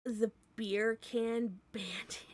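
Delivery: slow whispered question. Real, not acted. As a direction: murmur it, puzzled.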